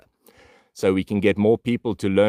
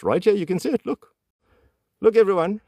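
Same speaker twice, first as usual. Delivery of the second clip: excited high voice; 12 semitones higher than usual. Acted. Excited pointing to viewers.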